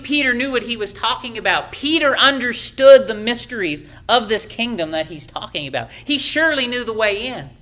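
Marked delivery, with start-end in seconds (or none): none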